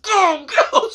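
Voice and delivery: high, strained urging; retold impatient girlfriend nudging him on; urgent prodding. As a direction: urge him along in a squeezed, high voice. Girlfriend urges him through checkpoint.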